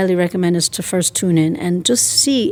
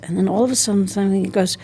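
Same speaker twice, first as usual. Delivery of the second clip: usual speaking voice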